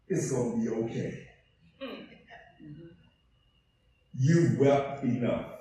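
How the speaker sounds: hushed reassuring elder; tender comfort. Acted. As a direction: gently soothe a weeping man. Tender comforting of weeping John.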